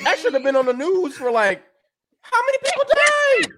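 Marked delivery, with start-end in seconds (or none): 2.2-3.6 high-pitched disbelieving squeal; squeaky, appalled question; incredulous outcry. Real, not acted. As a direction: voice shooting way up in disbelief. Repeats the question in outrage.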